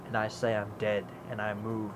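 low, soft imitated reply; quiet defiance. The crow answers the cop, imitated low quiet defiance. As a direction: answer softly without backing down.

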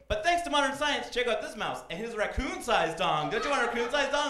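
hammed-up infomercial scientist; mock lab-coat pitchman.

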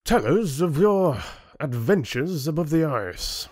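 high-pitched, mocking chieftain voice; sneering. A narrator's reading. Chieftain demanding Rin's tale of adventures, sneering. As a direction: goad him with a lordly sneer. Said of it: "the chieftain said with a sneer".

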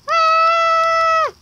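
high, loud scream; panicked scream. Real, not acted. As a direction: scream in fright. Chased by player.

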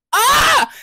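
high, loud scream of exhilaration; loud, high-pitched excited "ah!". Real, not acted. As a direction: burst out with a thrilled shriek. Thrilled about her future.

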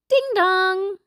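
sing-song two-note doorbell; doorbell chime. A narrator's reading. Doorbell rings at the farmhouse, sing-song chime. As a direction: chime it high, holding the last note.

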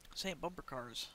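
quiet, weary mutter. Shown as a demonstration. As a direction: say it under your breath. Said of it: "How many times have I said that now?".

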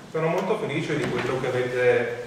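thin, distant microphone sound; talking into a bad mic; cheap usb mic. Real, not acted. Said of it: "il microfono".

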